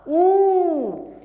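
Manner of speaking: ऊ stretched long, high and loud; long vowel. Shown as a demonstration. Teacher showing children the long ऊ, drawn out. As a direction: hold the ऊ out long to show the long vowel. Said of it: "अब मैंने ऊ खींच के क्यों बोला है?".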